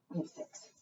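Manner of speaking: hushed whisper of "We have six."; conspiratorial whisper to the kids. Acted. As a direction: whisper it like a secret. Counting birds with children.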